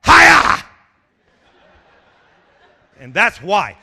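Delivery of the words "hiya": loud, high "Hiya!" yell; karate battle cry. Acted. Preacher yelling a karate cry at trouble. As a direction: shout it like a martial arts strike. Shouted at the boss.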